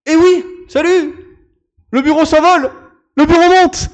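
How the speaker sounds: crié fort, très aigu; lance-le à pleine voix, surexcité; annonce triomphante. Real, not acted. Révèle le bureau qui monte. (crié avec enthousiasme)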